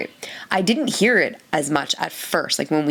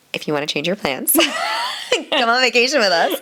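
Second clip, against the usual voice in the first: laughing invite; 9 semitones higher than usual. Real, not acted. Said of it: "teasing invitation, laughing".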